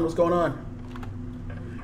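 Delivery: soft slow unvoiced whisper. Shown as a demonstration. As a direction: breathe it out slowly.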